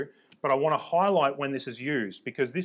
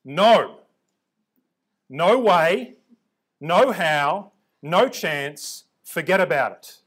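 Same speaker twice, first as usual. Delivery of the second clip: loud, punched mock refusal; playing a dad flatly refusing; dad's emphatic refusal; 3 semitones higher, 7 dB louder, much slower than usual. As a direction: shut the request down hard, one blow at a time.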